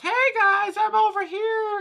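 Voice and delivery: very high, squeaky calling out; slow, shrill cartoon call; artwork yelling. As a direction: squeal for attention from across the room. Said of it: "artwork that yells".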